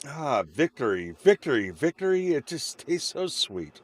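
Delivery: rising high and loud on victory, savouring it; gloating victory. Real, not acted. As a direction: crow over the win, then relish it. Savouring a win at stream end.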